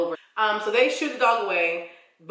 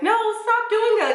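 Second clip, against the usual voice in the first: high, loud reenacted yell; retold scream; 5 dB louder than usual. Owner screaming at attacking pit bulls, high loud yell. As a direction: yell it high to call the dogs off. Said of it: "notion is to scream at the dogs".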